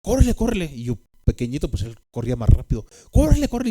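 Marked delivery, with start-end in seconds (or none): 0.0-0.7 loud, higher urgent call to run; urging shout. Retold or imitated. Older brother yelling at little boy to run, urgent higher shout. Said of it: "mi hermano iba". 3.1-3.7 loud, much higher "Córrele" shouted; urging shout. Retold or imitated. Older brother hurrying scared little brother home, loud high shout. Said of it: "mi hermano iba".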